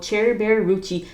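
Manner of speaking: ordinary delivery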